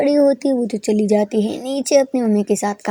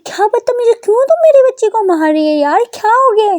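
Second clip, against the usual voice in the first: shocked aunt; 11 semitones higher, 7 dB louder than usual. A narrator's reading. Aunt stops the slap.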